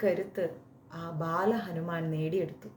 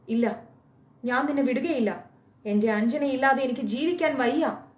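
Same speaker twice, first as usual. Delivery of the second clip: louder, higher pleading refusal; desperate plea; 5 semitones higher, 5 dB louder than usual. Acted. Kesari begs Anjana not to leave, louder and higher. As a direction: refuse to let her go, desperately.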